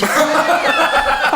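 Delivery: high burst of laughter. Real, not acted. Laughs at his surprise.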